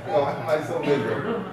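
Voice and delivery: soft, low, self-pitying moan; a hint of whiny gloom; mock self-pity. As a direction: sink into mock misery, quietly. Speaker imitates himself sulking.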